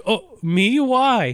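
high-pitched, incredulous questioning; mock surprise. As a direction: play a reluctant hero caught off guard. Reluctant hero's reply acted out.

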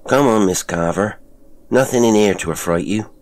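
calling out. A narrator's reading. Jonas urges Miss Carver into the grotto, calling out. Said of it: "Jonas called out".

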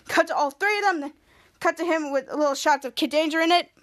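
ordinary delivery, nothing marked

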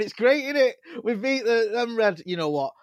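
loud, high, gushing celebration; overjoyed mock terrace cheer; jubilant fan. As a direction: shout it like a euphoric City fan hugging you.

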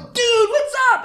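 hammed-up hearty hello; star's friendly greeting.

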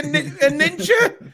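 drawn-out laughing ninja. Real, not acted. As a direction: laugh through the word. Teasing ninja comparison, laughing.

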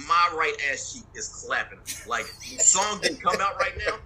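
normal talking voice

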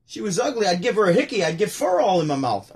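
high, loud joke delivery; Rodney punchline. Retold or imitated. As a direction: punch it to the back row. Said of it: "He goes".

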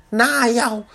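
high, loud put-on [name] voice; [name] impression. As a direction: do a quick [name] bit. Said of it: "I could do a [name] impersonation".

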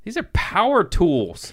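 high, mock-amazed cry; awed exclamation. Awed reaction to dad's tools.

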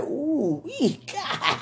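high, laughing squeal of delight; giddy swoon. Retold or imitated. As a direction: let it rise into a giggling gasp. Swooning over the smile.

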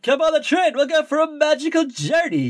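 high, sing-song coaxing; luring stranger. Retold or imitated. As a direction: promise magic to lure a kid. Luring train man voiced.

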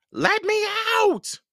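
trapped creature yelling; yelled. A narrator's reading. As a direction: shriek to be let out. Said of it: "Hogsquill yelled".

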